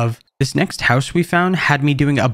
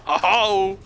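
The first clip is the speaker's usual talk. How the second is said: ordinary talk, nothing marked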